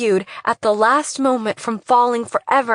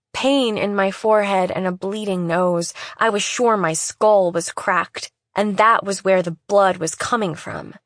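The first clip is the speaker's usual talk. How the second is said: plain reading voice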